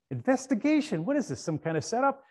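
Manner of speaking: shocked disbelief, high; alarmed. Retold or imitated. Customer reacting to the investigation news, high and incredulous.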